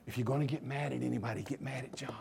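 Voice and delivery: whispered joking aside. Acted. Preacher to congregation, a playful whispered aside. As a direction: whisper it like a confidential joke.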